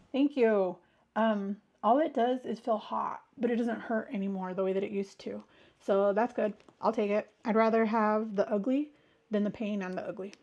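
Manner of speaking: usual speaking voice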